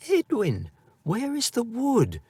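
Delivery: put-on high, worried girl's voice; a little sister's anxious high question; trembling lip. A narrator's reading. Worried girl asking her brother about the wood. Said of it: "with a trembling lip".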